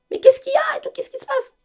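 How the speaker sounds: very high, loud panicked cry; little sister screaming what's going on; alarmed little sister. Retold or imitated. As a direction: shriek it like a scared girl rushing in.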